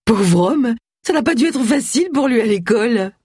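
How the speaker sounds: high-pitched, mirthful jibe; stifled amusement. A narrator's reading. Agatha mocks the name Marmaduke, sputtering with mirth. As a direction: tease as if holding back giggles. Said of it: "Agatha pouffa de rire".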